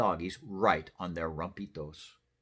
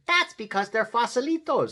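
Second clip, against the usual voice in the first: kitten voice; 9 dB louder than usual. Acted. Skipito explains.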